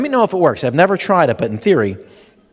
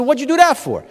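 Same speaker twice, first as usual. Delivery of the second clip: indignant; 8 semitones higher, 3 dB louder than usual. Retold or imitated. Husband hit with a pan.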